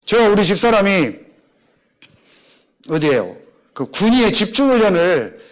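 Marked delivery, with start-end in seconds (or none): none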